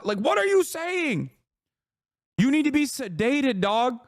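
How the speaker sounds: high-pitched incredulous outburst; exasperated disbelief. Real, not acted. Streamer reacting to absurd facial anatomy critique, exasperated outburst. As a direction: burst out high in baffled exasperation.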